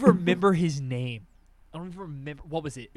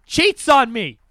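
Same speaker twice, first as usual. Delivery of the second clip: loud, high outburst of mock outrage; outraged outburst; 6 semitones higher, 10 dB louder, slower than usual. Griping to a friend about a childhood cheater, outraged. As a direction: blurt it out loudly, indignant.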